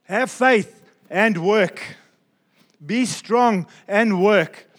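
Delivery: very slow, each word weighted, faith and strong hit hard; slow emphatic stress. Real, not acted. Preacher urging the congregation to underline it, slow and emphatic. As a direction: press every word home like a command. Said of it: "underline this in your Bible, highlight it, emphasize it".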